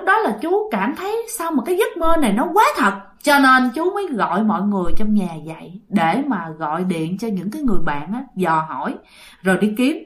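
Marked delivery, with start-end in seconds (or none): none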